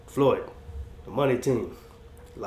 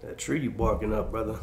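ordinary talk

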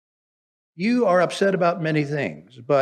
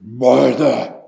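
sharp, louder single "Martha."; Jesus rebuking Martha with a loud "Martha."; harsh scolding; 5 semitones lower, 9 dB louder than usual. Shown as a demonstration. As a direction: bark the name "Martha." as a rebuke. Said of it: "He didn't just".

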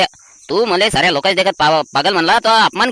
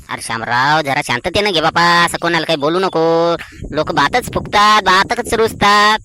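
low, slow, drawn-out advice; slow right down to settle him; calming admonition; 4 semitones lower, slower than usual. Acted. Elder tells Shamrao to keep his peace, calming.

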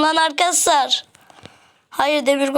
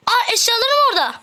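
high, fast surprised gasp; startled cry; 10 semitones higher, faster than usual. Real, not acted. Gamer spotting his dropped gear, startled. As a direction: blurt it out in shock. Sees lost items, startled.

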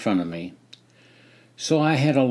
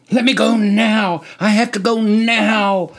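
loud, high growling demand; growled plea; 10 semitones higher, 6 dB louder than usual. Acted. Soldier demanding his turn, growling loudly. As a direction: grunt it out like a mad bull. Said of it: "sputtered between growls and grunts".

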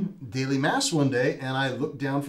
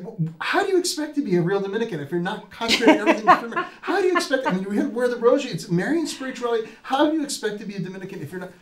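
hammed-up higher, louder, fast indignant sister, laughing through; mock intense nun; 9 semitones higher, 6 dB louder, faster than usual. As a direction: play the fired-up sister scolding a clueless student, fast and high. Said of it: "your reaction was pretty strong".

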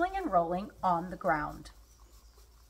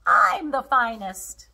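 loud, slow, higher-pitched boast; proclaiming; 4 semitones higher, 6 dB louder, slower than usual. A narrator's reading. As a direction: proclaim it as the champion tiger. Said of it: "proclaimed the tiger".

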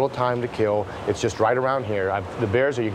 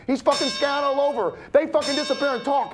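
pitched much higher, racing and wound up; manic rant; 10 semitones higher than usual. Acted. Man ranting about the money and the danger, manic and wound up. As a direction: spill it out like a paranoid man spinning. Long paranoid rant held high.

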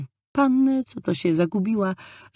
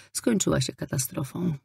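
ordinary delivery

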